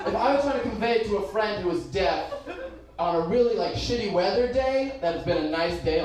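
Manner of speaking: ordinary delivery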